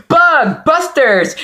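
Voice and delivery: high, slow, drawn-out hype call; excited announcer shout. Shown as a demonstration. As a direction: belt it like a show title. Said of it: "or as I like to say".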